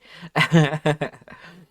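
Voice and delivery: loud nervous laugh after a fright; jump scare laugh. Real, not acted. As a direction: laugh off the shock. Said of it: "that jump scared me so bad".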